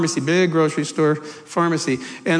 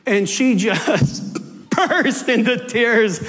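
ordinary delivery

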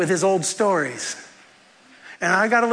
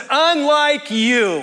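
high, drawn-out jab at the crowd; retold pointed yell; 7 semitones higher, 4 dB louder, much slower than usual. Teacher turns on the waiting line, yelling. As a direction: stretch it out as a public rebuke. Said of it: "he yells to the entire class".

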